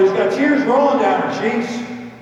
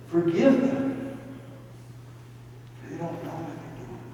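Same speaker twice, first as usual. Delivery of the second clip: barely able to talk; 4 semitones lower, 10 dB quieter than usual. Acted. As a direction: breathe it out like a dying man. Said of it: "he can barely talk".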